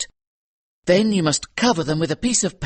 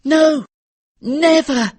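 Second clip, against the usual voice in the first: put-on much higher, loud frightened child; frantic boy's shout, higher and louder; boy crying out; 6 dB louder, slower than usual. A narrator's reading. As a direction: cry out desperate denials. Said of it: "cried the boy".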